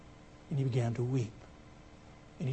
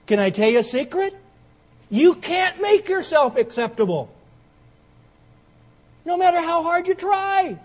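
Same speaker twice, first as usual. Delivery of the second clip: loud, much higher, slow preacher's cry; fiery preaching to the congregation; full-force preaching; 12 semitones higher, 11 dB louder than usual. Real, not acted. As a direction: build the sermon to its peak.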